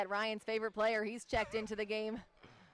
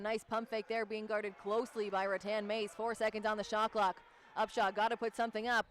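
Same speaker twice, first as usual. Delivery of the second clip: brisk courtside call; live play-by-play; 4 dB louder than usual. Acted. Play-by-play announcer calling the game for viewers. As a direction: call the action as it happens.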